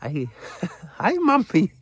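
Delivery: very high, put-on woman's voice; hammed-up high-pitched exclamations. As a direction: play a startled lady in falsetto.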